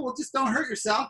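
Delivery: ordinary talk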